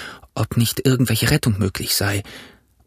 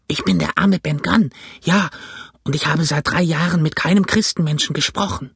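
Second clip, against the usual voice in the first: put-on raised, rusty old sailor voice; hoarse castaway; 6 semitones higher than usual. A narrator's reading. Castaway pleading to Jim, rusty hoarse voice. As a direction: speak like a voice unused for years. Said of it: "seine Stimme klang heiser und ungeschickt".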